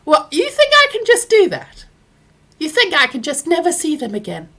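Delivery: can't believe it's that simple; incredulous. Retold or imitated. Mother asking son if she can really cut family off, high incredulous. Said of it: "And I said".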